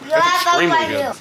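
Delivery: high, loud praise; delighted. Real, not acted. Praising the grouper cooked by Karen's mom, thrilled. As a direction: rave about the taste.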